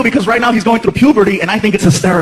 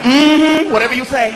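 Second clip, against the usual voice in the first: dismissive clerk; 3 semitones higher, slower than usual. Retold or imitated. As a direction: wave him off with sass.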